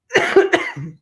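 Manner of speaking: choking cough, high and sharp; coughing fit. Real, not acted. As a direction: cough as it goes down wrong. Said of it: "it went down the wrong way".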